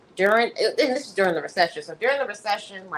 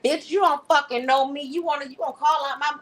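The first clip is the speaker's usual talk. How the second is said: mock anger translation; 8 semitones higher than usual. Speaker voicing Valerie's anger at Tiffany, mock furious. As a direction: spit it back like an anger translator. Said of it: "I am Valerie's anger translator".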